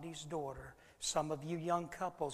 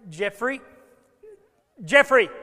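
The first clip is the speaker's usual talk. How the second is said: panicked scream of the name, higher and far louder each time; frantic shouting; 6 semitones higher, 16 dB louder than usual. Retold or imitated. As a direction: yell for the lost child at the top of your lungs. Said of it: "becomes more frantic".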